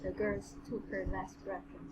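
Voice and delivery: ordinary delivery, nothing marked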